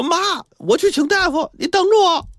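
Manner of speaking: put-on very high child calling out; acted shouting. A narrator's reading. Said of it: "还是大声地喊".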